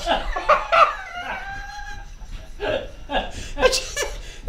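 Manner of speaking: long, high wheezy laugh; helpless laughter. Real, not acted. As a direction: crack up at your own punchline. Laughs at his own punchline.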